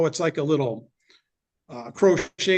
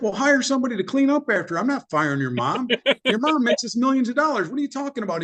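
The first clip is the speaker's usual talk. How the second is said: incredulous retort; 8 semitones higher than usual. Retold or imitated. Refusing son's plan to fire mom.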